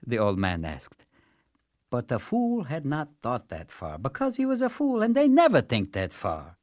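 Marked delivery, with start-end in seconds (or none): none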